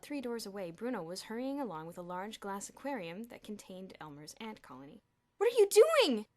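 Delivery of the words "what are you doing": high, loud shriek. A narrator's reading. Elmer catching Bruno with his ants, shrieking. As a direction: shriek it at the thief. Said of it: "Elmer shrieked".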